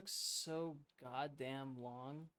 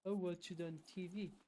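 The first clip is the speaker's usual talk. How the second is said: mocking voice; 4 semitones higher than usual. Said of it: "mocking the app".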